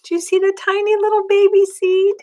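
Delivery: ordinary delivery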